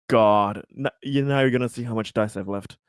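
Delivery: ordinary talk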